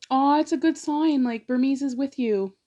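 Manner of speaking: a hint of a comforting mother; lightly voiced mom.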